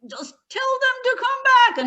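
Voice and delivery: high, loud, furious command; losing his temper. Acted. Grandfather losing his temper at his grandson, loud and high. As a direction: explode at the boy to call them back. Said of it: "he suddenly lost his temper".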